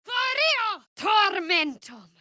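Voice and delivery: high, slow incantation shout; shouted spell. Acted. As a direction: hurl the spell at the enemy. Casting a spell in combat.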